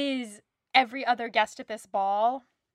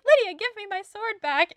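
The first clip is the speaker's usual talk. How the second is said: high-pitched mock scolding; imitating a character calling after Lydia; acted-out exasperated demand; 10 semitones higher, 4 dB louder than usual. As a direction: act out his demand, raised in pitch. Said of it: "and he's like".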